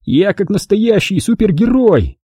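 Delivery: high, exuberant superhero boast; excited boasting. Acted. Wilson shows off his flying to friends, excited boast. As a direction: crow proudly, pitched well up.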